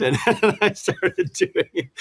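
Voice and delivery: slow and high, laughing through the words. Real, not acted. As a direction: chuckle as you remember it. Laughing while recalling first broadcast.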